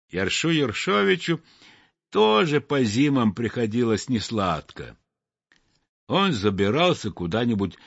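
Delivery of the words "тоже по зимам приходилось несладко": ordinary delivery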